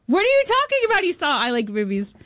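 high, incredulous yelp on what; stunned disbelief. Retold or imitated. Hearing that Adam Sandler saw her film, stunned disbelief. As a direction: she can't believe what she's hearing.

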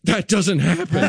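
high-pitched, stunned disbelief; mock terrified. Doctor after the baby flips, high mock-terrified disbelief. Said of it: "like, terrified".

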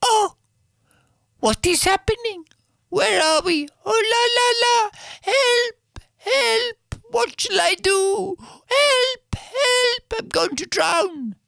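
very high, squeaky cries for help; a tiny princess shrieking as she wakes at sea; high frightened princess calling help, help; panicked princess. Acted. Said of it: "And then the princess wakes up and goes".

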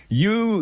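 "You" punched high as one person; stress on you. Shown as a demonstration. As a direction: hit the word "You" to mean only John. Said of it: "he didn't say, "You guys".

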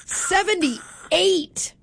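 mock alarm, drawn-out words; sarcastic. Host reading a mild Wednesday forecast, sarcastic mock dread. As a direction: make a mild forecast sound like a heatwave, stretching it. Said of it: "That's sarcasm for people who don't know".

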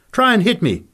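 loud, high-pitched dare; goading. Acted. Fighter dares Nick to strike, loud and aggressive.

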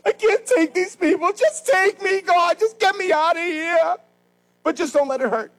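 high, pleading mock complaint; exasperated mock plea to God; whiny weary prayer. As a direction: whine it up high like a worn-out believer. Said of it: "Paul said, you ever do these things".

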